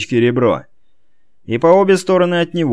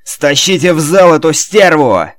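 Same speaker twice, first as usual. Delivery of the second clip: furious yell; 5 semitones higher, 7 dB louder than usual. Acted. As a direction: voice cracking with rage, loud and high. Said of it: "срывающимся от бешенства голосом закричал".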